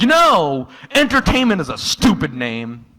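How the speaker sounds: sudden high, loud rant after brooding; angry outburst. Retold or imitated. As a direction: blurt it out like a grudge finally bursting. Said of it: "getting visibly angrier and angrier".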